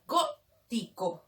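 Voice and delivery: slow "Gótico" broken into syllables, GÓ first and stressed; "Gótico" drawn out, stress on the opening syllable; split, antepenultimate stress. Shown as a demonstration. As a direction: say "Gótico" in pieces, leaning on GÓ. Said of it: "Esdrújula en la antepenúltima sílaba".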